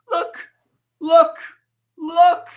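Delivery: high-pitched, insistent cries of look; sudden jump up into a squeaky high voice; squeaky excited look. Real, not acted. Showing off hat standing up.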